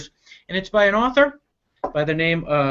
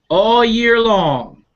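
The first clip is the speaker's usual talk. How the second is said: slow, drawn-out storybook opening; lively children's story narration; read-aloud storytelling; 2 semitones higher, 4 dB louder, much slower than usual. Acted. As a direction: read it like a picture book to kids. Reading picture book aloud.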